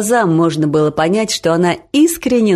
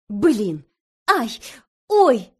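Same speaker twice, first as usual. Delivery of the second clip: yelp of pain; 4 semitones higher, much slower than usual. A narrator's reading. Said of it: "закричал будущий муж".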